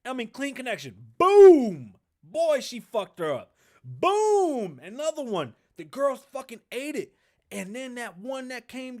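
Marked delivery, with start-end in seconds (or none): 1.2-1.9 loud, high punch sound; a big excited boom; shouted boom. Retold or imitated. Calling the punch. 3.8-4.8 loud, high punch sound; a big excited boom; shouted boom. Retold or imitated. Calling the punch.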